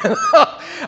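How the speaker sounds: a high, bursting laugh of delight; joyous laugh. Real, not acted. [name] laughing as he receives his new body, joyous laugh. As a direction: laugh out in sheer joy.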